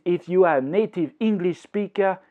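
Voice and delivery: normal talking voice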